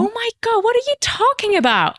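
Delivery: very high-pitched mock outrage; squeaky-high retold exasperation; exasperated disbelief. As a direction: act out an incredulous comeback. Retold exasperated reply to driver.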